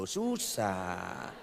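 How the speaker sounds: normal talking voice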